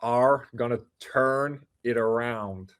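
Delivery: very slow, deliberate American R's; American accent. Shown as a demonstration. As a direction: lean on every R like an American. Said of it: "emphasize your R's like that".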